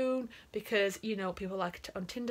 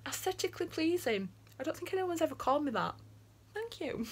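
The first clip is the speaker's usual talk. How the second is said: high, softening flattered murmur; flattered wonder; 7 semitones higher, 3 dB quieter than usual. Real, not acted. Reacting to being called aesthetically pleasing, flattered wonder. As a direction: marvel at the compliment in a high, light voice.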